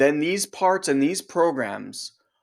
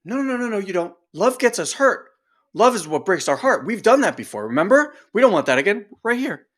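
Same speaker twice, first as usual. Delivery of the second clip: higher, louder mock nagging; scolding inner part; 6 semitones higher, 4 dB louder, faster than usual. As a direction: nag like an overprotective parent.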